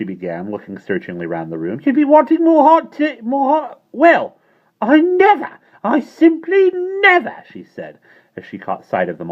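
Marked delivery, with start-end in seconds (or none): 1.8-7.4 very high, loud scandalised gasp; shocked landlady. A narrator's reading. Landlady catching them in the air, shocked. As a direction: clutch your pearls and gasp it out.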